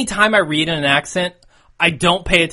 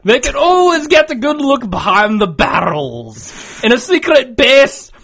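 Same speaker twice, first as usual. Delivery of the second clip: loud, higher-pitched broad Scots; Scottish accent; 8 semitones higher, 4 dB louder than usual. Pretend. Podcaster reading listener mail on request, broad Scottish accent. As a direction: declaim it in a rolling Scottish burr. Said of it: "do a Scottish accent, but I'll try".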